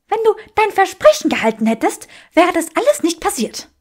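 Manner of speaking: furious woman blaming him; angry Granger. Acted. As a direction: rush the reproach at him. Granger accuses Draco.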